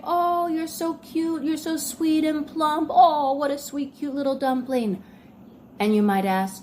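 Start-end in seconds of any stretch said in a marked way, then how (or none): 0.0-5.0 mock sweet. Fake sweet teasing compliment.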